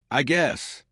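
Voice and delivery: small resigned sigh of a line; sad. A narrator's reading. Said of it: "but his voice sounded sad".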